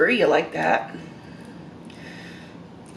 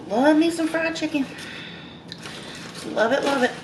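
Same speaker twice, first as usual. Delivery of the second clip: delighted; 8 semitones higher than usual. Real, not acted. Delighted by fried chicken.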